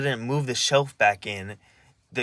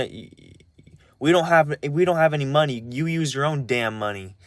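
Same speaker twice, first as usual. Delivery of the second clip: higher, exasperated imitation of grandpa; irritated grandpa; 4 semitones higher than usual. Imitating grandpa refusing to pay for shots, exasperated. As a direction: argue back heatedly, as if retelling it.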